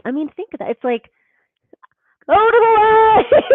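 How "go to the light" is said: high, loud and slow, like a mystic summons; hammed-up beckoning into the light; mystical call. Voicing the seed's urge.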